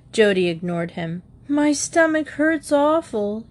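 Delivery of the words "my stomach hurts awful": moaning. Acted. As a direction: whine about the pain. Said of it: "he moaned".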